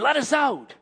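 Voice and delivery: high-pitched cry at ordinary volume; mock scream from trapped demons; retold demon scream. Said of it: "And they screamed".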